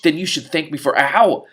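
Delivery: high, loud cry of ow; scratched mid sentence; sudden yelp of pain. Acted. Vamis yelps as the cat attacks, high and loud.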